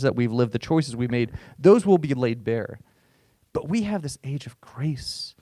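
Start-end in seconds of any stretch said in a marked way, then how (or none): 3.5-5.3 a whispered aside to the congregation; hushed whisper. Real, not acted. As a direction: drop to a whisper on grace. Preacher drops voice on grace.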